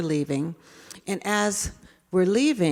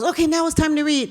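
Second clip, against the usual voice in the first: put-on higher, fast excited kid begging; eager child; 8 semitones higher, 3 dB louder, faster than usual. David begging the teacher to read, higher and fast. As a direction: rush it like a boy who wants a story now. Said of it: "and goes".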